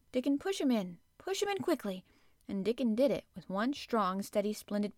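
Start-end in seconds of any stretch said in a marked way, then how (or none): 0.1-2.0 higher-pitched urging; urgent order. A narrator's reading. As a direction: urge him on with rising pitch. Mary urgently orders the push.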